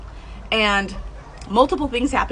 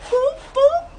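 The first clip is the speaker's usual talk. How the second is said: high cheerful boop boop noise; playful high-pitched beeps; mock beeping sound. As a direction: chirp it like a little alarm going off. Celebrating the first podcast.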